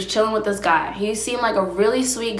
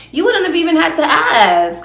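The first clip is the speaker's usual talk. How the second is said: emphatic; 8 semitones higher, 5 dB louder than usual. Real, not acted. Emphatic rant.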